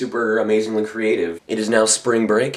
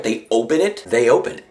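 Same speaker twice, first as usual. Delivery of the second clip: ordinary talk, nothing marked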